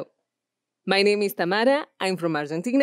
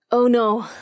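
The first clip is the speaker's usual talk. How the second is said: loud, higher cry of alarm; 5 semitones higher, 4 dB louder than usual. Shown as a demonstration. Shot soldier crying out in alarm. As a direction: gasp it out as the shot hits.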